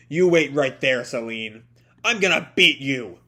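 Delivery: loud, fired-up hero threat; defiant challenge. Acted. Natsu challenging Selene.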